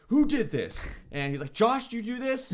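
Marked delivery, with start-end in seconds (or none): 0.1-0.9 higher, mock-furious demand; mimicking angry roommate. Roommate demanding who touched his pan, higher mock anger. 1.5-2.5 much higher, hammed-up accusation; mimicking accusing roommate. Roommate accusing Josh over the pan, higher mock voice.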